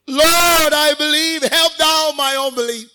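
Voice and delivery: loud, high, drawn-out desperate cry; tearful crying out. Acted. Father begging Jesus for his sick child, crying out loudly. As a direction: cry it out at full voice, pleading with heaven. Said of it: "cried out and said with tears".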